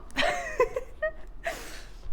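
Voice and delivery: high, soft laugh breaking into crying; tearful laugh. Real, not acted. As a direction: laugh through happy tears. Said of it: "getting so emotional".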